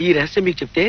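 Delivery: low, hushed conspiratorial tone; soft, secretive offer; confiding a secret. Acted. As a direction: drop pitch and volume to tempt him. Lawyer confides a lucrative secret.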